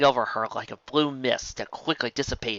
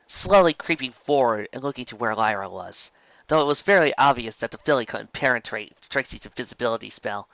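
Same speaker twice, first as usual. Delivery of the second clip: ordinary delivery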